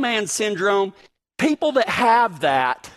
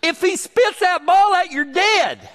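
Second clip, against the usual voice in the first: higher, mock-stern warning; mock threat; 7 semitones higher than usual. Owner warning Willie the Chihuahua, mock threat. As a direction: scold the little dog half-joking. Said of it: "I'm like".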